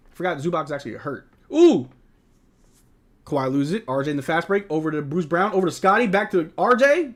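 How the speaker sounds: quick, lively game call; broadcast-style play-by-play. Acted. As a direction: call the action as it happens. Calling the game live.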